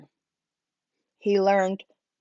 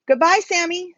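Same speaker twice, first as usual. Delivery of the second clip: higher, louder calling goodbye; children's goodbye; 9 semitones higher, 4 dB louder than usual. A narrator's reading. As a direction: kids calling after a friend. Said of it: "said the children".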